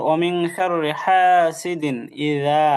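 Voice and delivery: slow chanted ইযা with careful dhal; measured model, long vowel on ইযা; dhal makhraj demonstration. Shown as a demonstration. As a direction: recite ওয়া মিন শাররি হাসিদিন ইযা slowly. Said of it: "দালের মাখরাজ".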